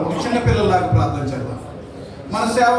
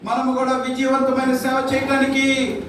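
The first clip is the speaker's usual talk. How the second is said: slow, rising prayer plea; fervent prayer; 3 semitones higher than usual. Acted. Pastor leading the church in slow prayer. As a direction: pray it out slowly, building.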